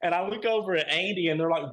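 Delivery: ordinary talk, nothing marked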